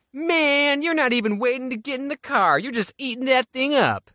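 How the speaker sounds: high-pitched imitation of a man yelling; hammed-up heckling from afar; mock yell. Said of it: "drives up to me and yells".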